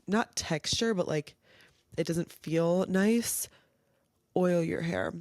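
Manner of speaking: ordinary delivery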